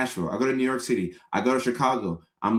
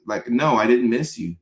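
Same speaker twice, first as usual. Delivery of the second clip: usual speaking voice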